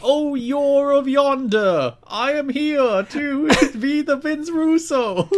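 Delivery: high, slow, grand fantasy voice; mystical D&D character. Acted. Host plays the wrestler as a D&D mystic, high grand mock voice. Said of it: "the voice he gave whatever mystical character".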